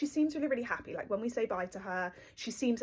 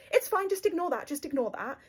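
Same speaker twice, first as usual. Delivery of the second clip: high, falsely light brush-off; smooth it over too brightly; forced breezy cover; 7 semitones higher, 4 dB louder than usual. Retold or imitated. Waving off the clerk about the help note, forced cheer.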